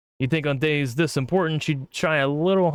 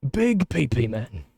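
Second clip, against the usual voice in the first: put-on slow British girl voice; lightly British, drawn out; British accent; 3 semitones lower, slower than usual. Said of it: "British [name]".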